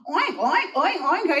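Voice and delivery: rapid excited oinking; alarmed pig oinks. Acted. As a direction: oink in a flap.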